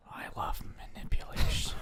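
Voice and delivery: breathy ASMR whisper. Pretend. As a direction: whisper it close to the mic. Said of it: "Why are you whispering?".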